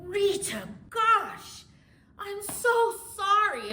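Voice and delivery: high, drawn-out mock apology; apologetic aunt. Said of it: "Here goes my aunt".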